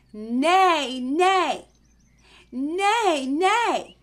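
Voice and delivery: loud high neighing; horse neigh. Shown as a demonstration. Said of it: "Can you neigh like a horse?".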